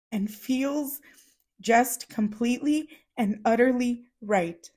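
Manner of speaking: reading through real tears, slow; holding back crying as she reads; choked-up slow reading; tearful reading. Real, not acted. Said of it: "I'm so sorry".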